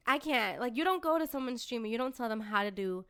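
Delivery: ordinary delivery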